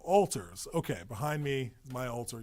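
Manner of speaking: normal talking voice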